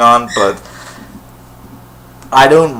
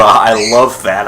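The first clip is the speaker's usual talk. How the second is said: ordinary talk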